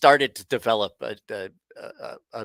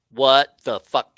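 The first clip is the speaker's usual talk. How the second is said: louder and slow, drawn-out disbelief; stunned outburst; 8 dB louder, slower than usual. Retold or imitated. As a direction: replay the moment you wake up somewhere you shouldn't be. Waking up in his car at night.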